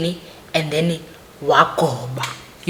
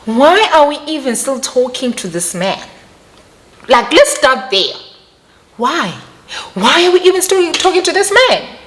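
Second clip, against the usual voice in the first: high, louder exasperated question; indignant; 9 semitones higher, 3 dB louder than usual. Real, not acted. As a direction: demand it in disbelief.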